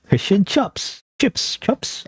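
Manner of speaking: high, drawn-out fake Australian; attempted Australian accent. Gamer testing an accent he can't do, exaggerated Australian. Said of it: "I can't do Australian accent".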